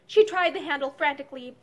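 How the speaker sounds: ordinary delivery, nothing marked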